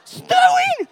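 high, loud, squealing "It's snowing!"; excited teachers. Retold or imitated. Teachers tweeting about snow, high and loud, thrilled. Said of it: "the teachers are all excited".

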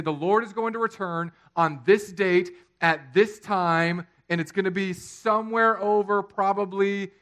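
loud, high proclamation; hammed-up preacher's prediction; mock doomsday preacher. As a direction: announce it grandly like a billboard prophet. Imitates date-setting billboard preachers.

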